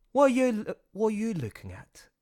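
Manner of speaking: put-on British accent, testing the line; full British accent. Trying out Louis's line, full British accent. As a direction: go fully British on it. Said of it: "So I'll go full British".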